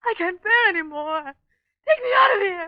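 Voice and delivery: building from a pleading cry to a high crying shout, slowing down; hysterical pleading. Acted. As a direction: beg to be taken away, sobbing as it breaks into a scream. Terrified wife begs to leave.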